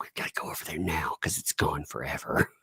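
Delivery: low, hushed doom; soft, mock-solemn urgency; little one's grave urgency. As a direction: imitate a child announcing a tragedy. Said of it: "the way the little one made it sound".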